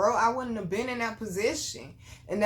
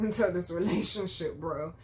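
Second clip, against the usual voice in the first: ordinary delivery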